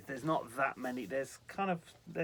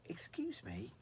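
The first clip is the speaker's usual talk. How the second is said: softer, hoarse voice; 3 semitones higher, 5 dB quieter, slower than usual. A narrator's reading. Frightened girl addressing the stranger in her room, hoarse voice. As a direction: croak it out timidly. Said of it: "said in a hoarse voice".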